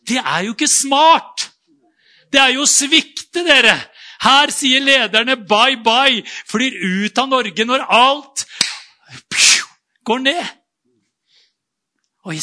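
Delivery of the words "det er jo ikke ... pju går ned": loud, high, scandalised protest; mock outraged shout. Telling the church how the congregation would shout in outrage. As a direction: cry out that leaving is betrayal. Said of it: "Vi satt jo og pratet helt sånn fornuftig".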